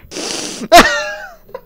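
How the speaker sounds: loud shrieking laugh; very high cackle. Acted. Comedian cackles after his Kim Jong-un joke, very high. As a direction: squeal with delight.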